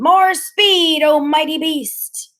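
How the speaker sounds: high, slow, ringing battle cry; shouting. A narrator's reading. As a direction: shout it like a starship captain. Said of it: "Freak is shouting".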